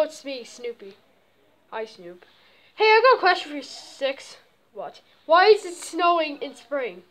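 ordinary talk

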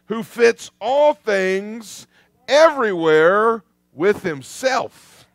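slow, loud and ringing, each phrase driven home; preaching at full force. Acted. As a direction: preach it out to the congregation, slowing on every phrase. Preacher builds to a peak.

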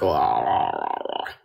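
mock croaky voice with no voice left, trailing off; imitating a lost voice. Telling a friend how her sister's friend sounds now, mock croak. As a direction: lightly mimic a friend whose voice is gone from vaping. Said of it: "her voice is completely gone".

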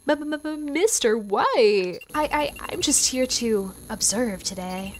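stammering, drawn-out first words; nervous stutter. Acted. Gale turns up at the lab to observe, nervous stutter. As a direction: trip over the opening sounds. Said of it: "why are you stuttering like that?".

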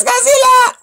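very high, loud shriek; mock panicked scream. Reader joking during the car chase, mock panicked scream. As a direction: scream it like a hammed-up monster-movie victim.

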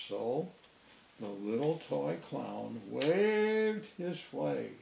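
plain reading voice

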